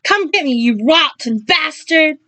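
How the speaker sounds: furious screamed taunt. Acted. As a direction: yell a challenge at the monster. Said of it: "she screamed".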